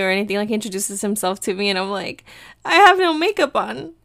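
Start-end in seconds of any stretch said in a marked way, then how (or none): 2.6-3.9 high, louder mock dismay; retold embarrassment. She faces a follower unprepared, retold embarrassed protest. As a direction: act out a mortified admission. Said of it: "so embarrassed".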